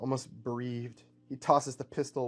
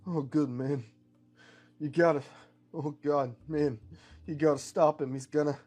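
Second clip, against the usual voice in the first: halting, urgent words from a wounded man; lightly acted struggle to get the warning out; dying cowboy; 2 semitones higher, 4 dB louder than usual. Wounded Terra Man warns Min to stop him, halting.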